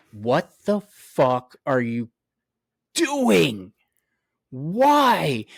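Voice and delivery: slow, drawn-out exasperation, each word stretched; stretched-out, incredulous questions; exasperated rant. Real, not acted. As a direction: rant in disbelief, dragging out DOING and WHY. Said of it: "I'm done with the rant".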